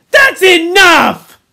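slow, high, loud command; Ralph, sharp. A narrator's reading. Ralph silences Piggy's excuses, sharp shout. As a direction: cut him off with a sharp shout. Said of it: "said Ralph sharply".

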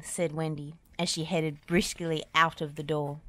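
ordinary delivery, nothing marked

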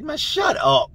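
high-pitched scoffing disbelief; acted-out incredulous dismissal; mock disbelief. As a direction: wave off a tall tale, voice way up. Podmates scoff at his claim.

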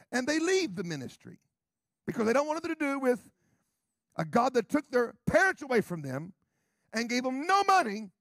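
high, loud, impassioned preaching; loud, urgent sermon delivery; full-force preaching. Real, not acted. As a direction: hammer it home from the pulpit.

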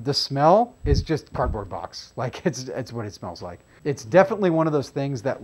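muffled behind respirator; speaking into mask; through a face mask. Real, not acted. Seen 1: Mask covers his mouth and nose throughout the labelled words.

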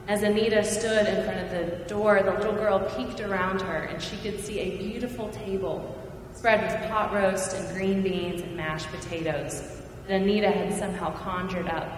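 normal talking voice

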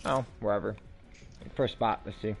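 ordinary talk

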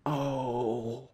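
soft, low whimpering groan; defeated moan. Real, not acted. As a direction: let out a small, beaten moan. Defeated moan after failing.

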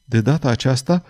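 ordinary delivery, nothing marked

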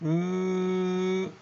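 "ني" held long as one ny sound; ny sound demo. Shown as a demonstration. Teacher introducing the combined gn sound, held long. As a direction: hum out a long sustained "ني". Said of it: "بحرف جديد اسمه".

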